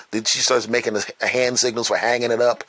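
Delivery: ordinary talk, nothing marked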